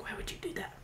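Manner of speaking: whispered mock-grumpy rabbit voice; a hint of an angry rabbit; mock angry bunny. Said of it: "like all angry".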